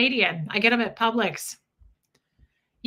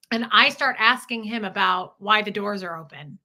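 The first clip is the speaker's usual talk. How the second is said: ordinary talk, nothing marked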